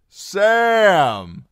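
loud, high hail to a friend; drawn-out ringing shout; ringing call. Acted. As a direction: shout the name across a noisy room. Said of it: "Darion's familiar voice rang out".

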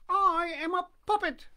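put-on high, squeaky wooden-boy voice; high puppet voice. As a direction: pipe it up like a puppet.

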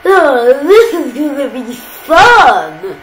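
loud, slow, cackling villain; gleeful villain voice. Acted. Green and blue monsters released, gloating loudly with a laugh. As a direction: gloat with a laugh, savouring every word. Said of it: "I'll try and do a voice for this as well".

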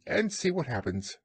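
high-pitched evasive rabbit voice. A narrator's reading. Rabbit dodges Pooh's greeting, high voice. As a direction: put on a high little animal voice. Rabbit answers Pooh in story.

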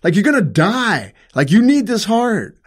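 high, loud, exasperated shouting at mom; angry yelling. Retold or imitated. Son pleading with his dying mother, shouting angrily. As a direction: yell it at her, furious and desperate. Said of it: "I was so angry with her".

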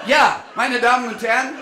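loud high call to the passengers; announcer shout. Acted. Tour guide hailing his passengers, loud and high. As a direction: hail the crowd like a tour guide.

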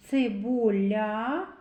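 цибуля slowly stretched, rising on ля; drawn-out sounding-out. Shown as a demonstration. Teacher leading children to the sound л, slowly drawn out. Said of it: "звуковий аналіз слова цибуля".

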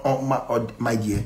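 ordinary talk

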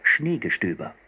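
ordinary delivery, nothing marked

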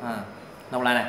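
normal talking voice

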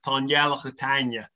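"Tá ngealach a tainne" spoken in Irish, with ngealach opening on a nasal ng; Irish Gaelic phrase. Shown as a demonstration. Demonstrating "Tá ngealach a tainne" for the podcast listeners, in Irish. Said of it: "please give us a little Irish Gaelic".

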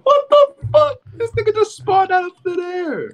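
high-pitched startled yelp; shocked shriek. Real, not acted. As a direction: squeal it out in disbelief. Stranger spawned out of thin air.

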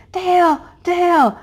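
high, loud call across the yard; yelling to warn of a fox; hollering for husband. Retold or imitated. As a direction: call out to Dale in alarm. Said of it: "I'm hollering".

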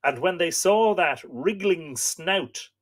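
slow, solemn storytelling read; story narration. A narrator's reading. Host reading myth to live viewers, solemn narration. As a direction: read the old myth aloud, grave and steady. Reads Irish myth aloud.

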